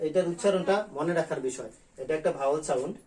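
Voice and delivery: normal talking voice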